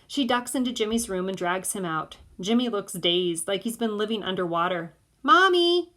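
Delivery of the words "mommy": high, loud call to mom. A narrator's reading. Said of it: "she calls to Missus Madaman".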